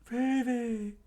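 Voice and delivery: high falsetto call; acted cry to someone far above. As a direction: shout the name high and drawn out. Crew member calls out to Vivi.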